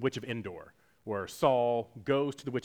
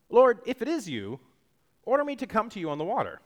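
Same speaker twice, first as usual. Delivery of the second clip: eager plea; 10 semitones higher, 6 dB louder than usual. Acted. Peter begging Jesus from boat.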